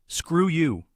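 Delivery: spat. A narrator's reading. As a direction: fling it back at him in defiance. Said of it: "Ava spat".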